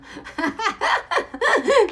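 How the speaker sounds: squealing burst of laughter; real giddy cackle; high delighted laugh. Real, not acted. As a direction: laugh high and loud at herself. Laughing at her saved stained papers.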